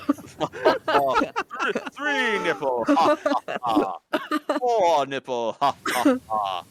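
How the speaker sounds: slow, hammed-up vampire count with a laugh; Count counting parody. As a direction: count nipples like the Sesame Street vampire. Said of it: "the guy from Sesame Street".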